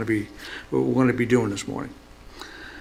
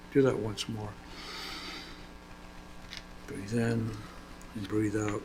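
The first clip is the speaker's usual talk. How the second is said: soft, slightly lowered meditation voice; guided meditation; 2 semitones lower, 9 dB quieter than usual. Real, not acted. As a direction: ease them into relaxing quietly, with long pauses.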